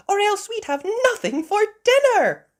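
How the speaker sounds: very high, loud mother voice; Mummy Sheep. Acted. Mummy Sheep relieved about dinner, high loud voice. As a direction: exclaim it high.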